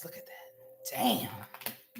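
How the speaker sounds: whispered under breath; whispering. Real, not acted. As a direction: breathe it out, awed. Reacting to drawn cards.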